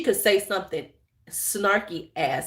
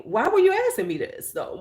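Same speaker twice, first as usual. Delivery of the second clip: sharp, much higher, suspicious question; incredulous why; 9 semitones higher than usual. Retold or imitated. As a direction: demand to know why, pitched way up. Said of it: "And I'm like".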